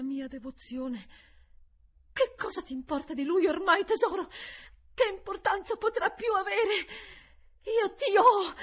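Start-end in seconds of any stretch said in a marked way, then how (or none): 2.1-8.5 high, loud, fervent cry; full-voiced frantic outburst; impassioned shout. A narrator's reading. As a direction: cry out in triumphant possession. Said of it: "gridai rivolta all'essere immondo".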